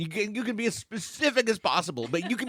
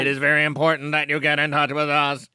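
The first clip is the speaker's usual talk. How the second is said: robot voice. Retold or imitated. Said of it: "obviously a robot voice".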